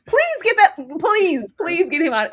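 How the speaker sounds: high loud please, fading down; exasperated plea. Retold or imitated. Viewer begging the producers, exasperated. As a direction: beg them to get him off screen.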